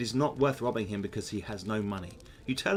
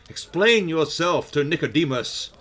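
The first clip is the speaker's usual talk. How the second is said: raised, louder stern command; 5 semitones higher, 7 dB louder than usual. A narrator's reading. Old wizard confronts a visitor at his door, stern. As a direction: challenge the visitor sternly and loudly. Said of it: "looks at you sternly and says".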